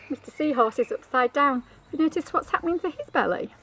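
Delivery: ordinary delivery, nothing marked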